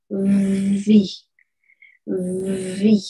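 Vi with a buzzing voiced v. Shown as a demonstration. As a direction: hum the v in Vi. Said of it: "pronunciarla con un pequeño zumbido". (Vi con un pequeño zumbido en la v)

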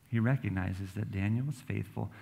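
ordinary delivery

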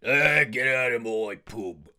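aggressive and loud. Shown as a demonstration. Arthur throwing someone out, loud and aggressive. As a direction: bark it like a pub bully. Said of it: "very aggressive and loud".

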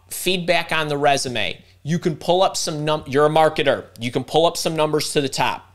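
usual speaking voice

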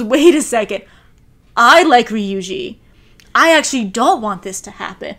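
mock outburst of a girl realizing her feelings; loud realization. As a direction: call it out as the truth hits her. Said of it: "she literally goes".

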